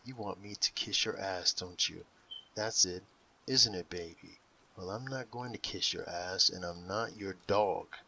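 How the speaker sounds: ordinary delivery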